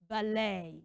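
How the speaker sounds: "ballet" as buh-LAY; AY ending. Shown as a demonstration. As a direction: finish "ballet" on long AY, silent T. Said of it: "we pronounce B A L L E T as".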